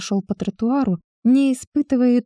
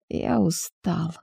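hoarse, much softer and slow; 3 semitones lower, 7 dB quieter, much slower than usual. A narrator's reading. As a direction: rasp it out, worn out and slow. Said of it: "прохрипел Семён".

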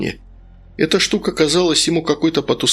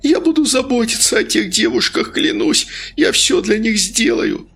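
frantic pleading; 10 semitones higher than usual. Acted. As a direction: beg in terror. Denis keeps screaming vows.

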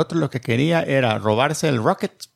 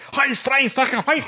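Nazi officer shouting; 10 semitones higher, slower than usual. Retold or imitated. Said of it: "Entonces el nazi".

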